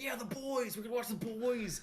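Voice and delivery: high-pitched hyped-up fan cheer; excited squeal of a quote. Retold or imitated. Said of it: "just getting excited for something".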